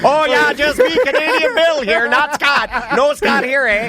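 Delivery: high-pitched, hammed-up Canadian accent; mock Canadian. Host posing as Canadian Bill to hide the guest's identity, mock Canadian accent. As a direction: play a chirpy cartoon Canadian. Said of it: "He became Canadian all of a sudden".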